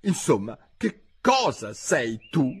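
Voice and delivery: high, slow demand; high bird voice, challenging; impatient pigeon. A narrator's reading. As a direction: stretch the question out, high.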